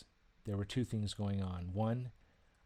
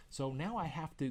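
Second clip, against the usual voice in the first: ordinary talk, nothing marked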